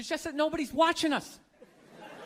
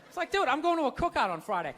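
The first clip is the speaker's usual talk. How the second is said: ordinary talk, nothing marked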